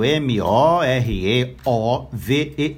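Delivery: M O R E O V E R spelt letter by letter, slowly; spelling it out. Shown as a demonstration. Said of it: "É juntinho".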